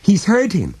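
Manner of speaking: very high, loud, panicked woman; alarmed cry. A narrator's reading. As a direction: cry out in horror, pitch way up. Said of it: "she cried".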